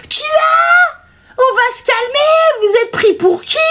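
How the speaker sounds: high, indignant mock outburst; outraged caller. Client objects to being told to calm down, outraged. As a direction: imitate an offended client, stretched and high-pitched.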